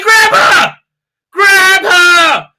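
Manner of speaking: high, very loud yell; retold frantic shout. Owner yelling after the runaway dog, frantic shout.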